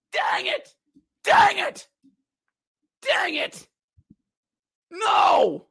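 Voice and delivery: high, crying repeated curse; anguished cry. Real, not acted. Announcer after the losing goal, crying out. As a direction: wail it over and over.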